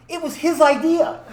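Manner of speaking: loud, high accusing punchline; blurted blame. Retold or imitated. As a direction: suddenly shift the blame at full voice. Blames friend to the cops.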